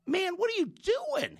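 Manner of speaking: can't believe his friend just jumped in; shocked disbelief. Retold or imitated. Man reacts to his friend jumping into the hole, shocked.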